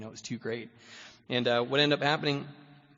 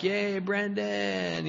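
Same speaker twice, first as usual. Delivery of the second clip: high, drawn-out sarcastic cheer; mock cheering crowd; 9 semitones higher, much slower than usual. Treatment peers cheering him sarcastically, high and drawn out. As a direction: stretch the yay way up, teasing. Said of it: "And they're all like".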